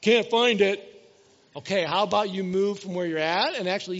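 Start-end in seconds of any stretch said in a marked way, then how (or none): 0.0-0.9 put-on loud, whiny kid's complaint; whining kid. Kid yelling from the room that nothing's there, loud whine.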